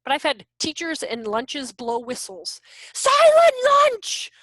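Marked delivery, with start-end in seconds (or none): 2.9-4.3 high, loud barked order; mock teacher yell. Lunch monitor yelling at kids, loud.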